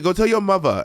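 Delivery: mock a New York girl saying "mother" as "muvva"; mimicked New York pronunciation. Host quoting a schoolgirl, mimicking her New York "mother". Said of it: "the way she said mother and father".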